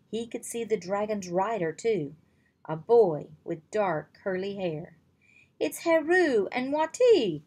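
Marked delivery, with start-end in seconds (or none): 5.6-7.4 high, loud cry of recognition; excited cry. Acted. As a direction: shout it out, thrilled to see them. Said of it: "Drake cried".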